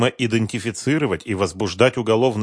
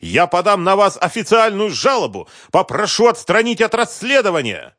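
high, loud shouted threat; furious threat; 10 semitones higher, 4 dB louder than usual. Acted. Businessman threatening a complaint, furious shout. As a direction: threaten him at full volume. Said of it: "продолжал бушевать Концевич".

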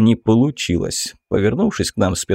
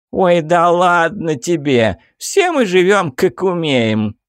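sassy drag queen; 7 semitones higher than usual. Acted. Camp character protesting.

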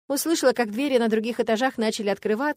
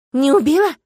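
raised, startled question; taken aback, calling from the doorway; surprised; 6 semitones higher, 10 dB louder than usual. A narrator's reading. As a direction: ask it in loud, high-pitched surprise. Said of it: "донеслось удивлённо от двери".

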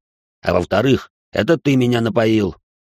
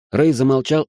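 ordinary delivery

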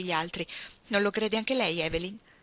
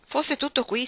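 higher, louder grumble; complaining; 7 semitones higher, 5 dB louder than usual. A narrator's reading. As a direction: complain with a touch of exasperation. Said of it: "si lagnò Grady".

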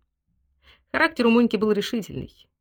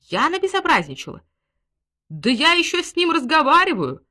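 high-pitched, outraged retort; rising scandalised exclamation; indignant outburst; 9 semitones higher, 4 dB louder than usual. A narrator's reading. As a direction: protest shrilly at the accusation. Woman indignant at servant's accusation.

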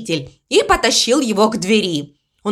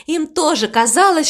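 usual speaking voice

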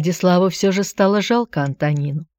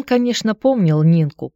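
ordinary delivery, nothing marked

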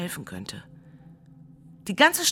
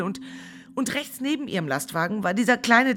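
usual speaking voice

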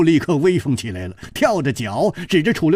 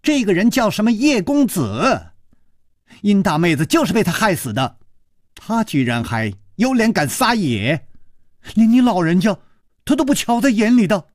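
high, agitated acted shouting; loud calling out; 6 semitones higher than usual. A narrator's reading. Daughter denouncing the stranger to her father, raised shouting voice. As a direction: accuse him in a raised, high-pitched voice. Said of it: "施少奶奶正在大声地喊".

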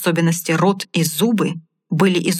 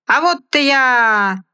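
high, slow stage shout; loud cry; 6 semitones higher, 4 dB louder, slower than usual. Acted. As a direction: call it out to the crowd. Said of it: "громко крикнул хозяин".

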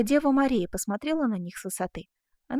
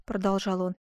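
plain reading voice

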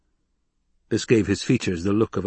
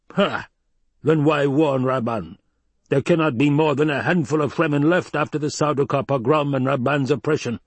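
higher-pitched, scornful dismissal; scoffing; 7 semitones higher, 4 dB louder than usual. Acted. Baron dismisses the Fremen as recruits, scoffing. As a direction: pour scorn after a sharp "Ha!".

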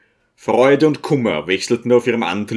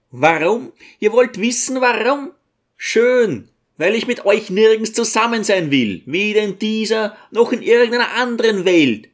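raised, much higher bitter retort; angry outburst; 10 semitones higher than usual. A narrator's reading. Teacher lashing out at his mother before guests, raised and angry. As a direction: throw the words back at her in contempt.

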